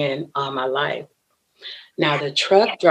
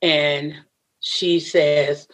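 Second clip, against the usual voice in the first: usual speaking voice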